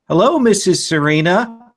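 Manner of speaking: a lightly voiced nervous teen greeting a strange mom; solid voice. Player greets the vampire mom in a firm voice. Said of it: "a solid voice".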